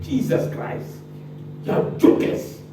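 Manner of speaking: breathy, under the breath in disbelief; exasperated muttered aside. Real, not acted. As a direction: mutter it to yourself, fed up.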